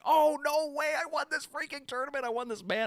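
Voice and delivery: high, excited disbelief; can't believe the win, voice way up; thrilled. Retold or imitated. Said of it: "And I was like".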